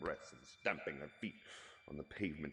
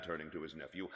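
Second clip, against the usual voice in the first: plain reading voice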